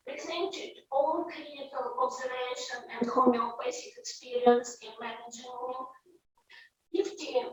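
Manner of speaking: very faint, far from the mic; hard to hear; barely audible. Real, not acted. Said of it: "your voice is very low right now".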